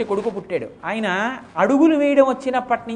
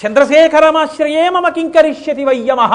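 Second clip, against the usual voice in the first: higher, much louder, triumphant verse line; defiant loud recitation; 7 semitones higher, 9 dB louder than usual. Acted. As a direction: hurl the verse at death in defiance. Boy defies Yama with verse.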